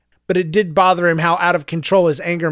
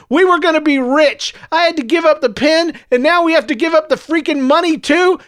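much higher and louder rant; angry outburst; 11 semitones higher, 4 dB louder than usual. Acted. Son ranting at his mother in the car, shouting. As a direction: let the anger run away.

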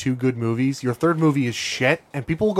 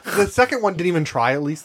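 usual speaking voice

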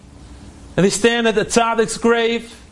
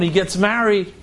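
ordinary delivery, nothing marked

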